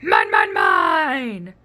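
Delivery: a small dino bellowing its claim; roaring Minosaur. Acted. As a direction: roar it like a greedy dinosaur. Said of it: "they heard a roar".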